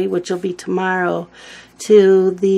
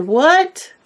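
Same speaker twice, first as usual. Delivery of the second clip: high, loud incredulous "What?"; retelling the siblings' stunned reaction, pitched up; retold disbelief; 7 semitones higher, 5 dB louder than usual. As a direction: echo the siblings' shocked disbelief. Siblings react to surprising news.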